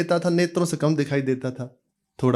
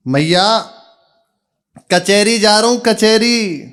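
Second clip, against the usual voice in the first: calling out loudly; 6 semitones higher, 5 dB louder than usual. Acted. Son repeats loudly to deaf mother.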